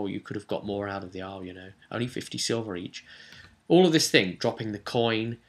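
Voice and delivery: normal talking voice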